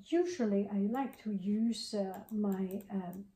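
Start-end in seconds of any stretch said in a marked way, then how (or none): none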